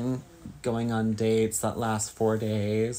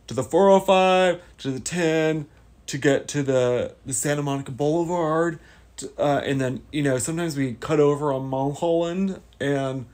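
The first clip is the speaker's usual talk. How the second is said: SNL California dude; 3 semitones higher, 6 dB louder than usual. Acted. Doing an SNL California bit for chat, valley-dude voice. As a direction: ham up the California surfer voice. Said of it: "I don't know the voice. I'm just trying to do, uh, more, uh, S N L vibes".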